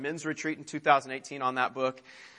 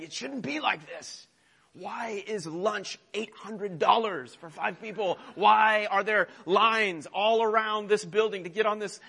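higher, whiny mock grumble about Disney prices; grouchy dad complaining; 5 semitones higher than usual. Grouchy dad at Disney grumbling over lunch prices, mock whiny. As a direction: play the fed-up dad in the queue. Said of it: "muttering things under our breath saying".

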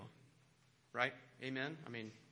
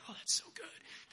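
high, breathy gush; mock swooning; 13 semitones higher, 6 dB quieter than usual. American listeners charmed by a lecturer's accent, mock swooning. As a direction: gush softly as if utterly charmed.

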